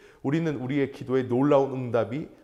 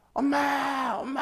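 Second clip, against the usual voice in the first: put-on high child's call of "엄마"; child calling mom; 9 semitones higher, slower than usual. Daughter waking beside dad, calling for mom, high and plaintive. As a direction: cry out for mom like a little girl.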